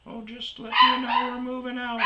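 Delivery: put-on high, breezy old lady voice, far up; a high, airy old lady excuse; mock old lady. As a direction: play the oblivious old woman chirping. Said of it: "this old chick".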